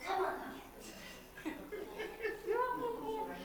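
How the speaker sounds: high, very soft and very slow, with a little laugh; reassuring the dog. Real, not acted. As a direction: soothe the frightened dog. Calming the nervous dog.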